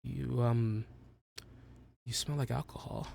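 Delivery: a teacher losing her nerve, quietly; nervous professor. Acted. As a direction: professor on the edge of a breakdown, hesitant and soft. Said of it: "she's having a professor breakdown".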